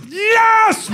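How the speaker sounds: high shouted little dog cheer. Retold or imitated. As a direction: yell it like a thrilled tiny dog.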